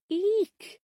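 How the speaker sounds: high, shrill little squeak of fright; playful shriek of mock horror; mock squeal. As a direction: squeal like a startled girl. Reacting to Silence of the Lambs.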